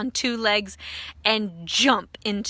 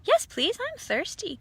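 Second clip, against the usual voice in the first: high, tiny pleading voice; a small creature begging to drink; high tiny cry; 10 semitones higher than usual. A narrator's reading. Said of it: "cried the tiny voice".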